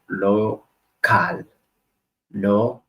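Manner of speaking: local said lo-CAL, stress on the last syllable. Shown as a demonstration. Teacher shows learners the Spanish stress in local, lo-CAL. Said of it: "stressed on the last syllable".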